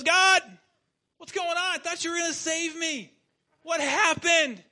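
high, loud, lightly put-on voice of a baffled man; a hammed-up plea, asking what went wrong; bewildered complaint. Drowned man asks God why he was not saved, high and loud.

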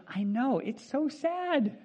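high, slow sing-song fake pity; mock sympathy. Counselor mocking the late student, sing-song pity. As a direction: coo it with fake sadness. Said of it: "And she goes".

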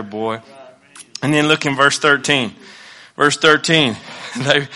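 ordinary talk, nothing marked

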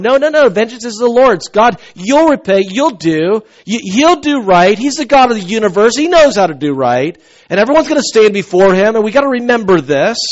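high, loud, full-force preaching; fervent preaching. Acted. Pastor declaring to his congregation that vengeance is God's, fervent preaching. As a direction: proclaim it to the back row.

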